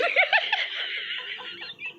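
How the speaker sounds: shrieking high laugh; laughing hard at her own joke about the husband. Real, not acted. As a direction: burst into a high-pitched laugh.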